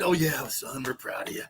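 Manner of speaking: lightly voiced doting father; mock father. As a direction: placate the son gently, low. Voicing the dad again.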